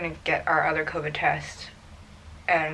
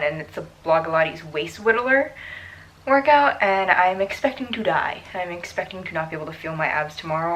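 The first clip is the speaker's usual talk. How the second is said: usual speaking voice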